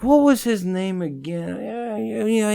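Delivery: high, mocking fake forgetfulness; imitating the host pretending to forget a name; mocking imitation. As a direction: play dumb in a high voice. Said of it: "But he's like".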